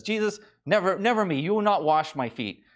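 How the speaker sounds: a lightly voiced Peter refusing hotly; indignant Peter. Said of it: "he says".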